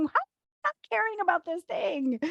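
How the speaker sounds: worked-up disbelief. Retold or imitated. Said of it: "Maybe it's you going".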